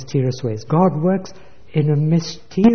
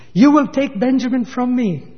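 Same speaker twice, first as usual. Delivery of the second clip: high, pointed echo of the complaint; mocking echo; 9 semitones higher, 4 dB louder than usual. Preacher recalling Jacob's fear to the congregation, a high mocking echo. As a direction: throw the old fear back, half amused.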